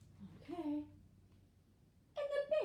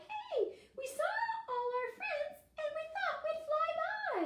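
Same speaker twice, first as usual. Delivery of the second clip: high, piping bird voices; high-pitched storybook bird; chirpy bird characters; 11 semitones higher than usual. Acted. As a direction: pipe it up high like a little bird. Raven and Wren speak in story.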